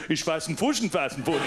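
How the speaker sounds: lightly put-on fake German gibberish; a hint of mock German babble. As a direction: fake German nonsense aimed at the English tourists. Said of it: "I got their accent a bit wrong".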